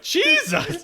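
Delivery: high, loud shocked cry; shocked exclamation. Retold or imitated. Friend on the podcast reacts in shock, loud exclamation. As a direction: blurt it out in disbelief. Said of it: "And then one of them's like".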